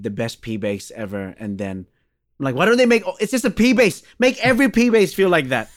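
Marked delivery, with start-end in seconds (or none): 2.5-5.7 high, loud comic protest; an over-the-top, high-pitched complaint; mock exasperated rant. Retold plea about Fender's basses.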